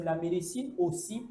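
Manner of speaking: ordinary talk, nothing marked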